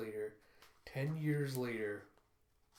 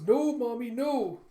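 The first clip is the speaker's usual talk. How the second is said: put-on high, loud sobbing little boy; a child begging his mother to stay; wailing child; 11 semitones higher, 11 dB louder, slower than usual. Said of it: "He goes".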